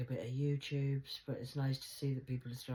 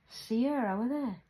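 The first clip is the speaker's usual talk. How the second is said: Geordie accent; 8 semitones higher, 5 dB louder than usual. Shown as a demonstration. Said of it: "In a Geordie language".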